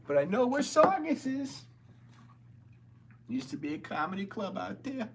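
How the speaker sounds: high-pitched put-on Boston accent; Bill Burr. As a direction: keep up a hammed-up Boston accent. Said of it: "That's the Bill Burr impression I can do".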